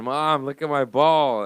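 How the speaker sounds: high, loud, slow call; calling out to mom. Retold or imitated. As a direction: yell it down the hall, stretched.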